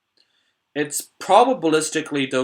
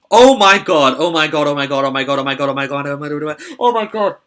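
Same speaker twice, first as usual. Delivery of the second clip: excited; 2 semitones higher, 8 dB louder, faster than usual. Real, not acted. Slam hand appears, excited commentator.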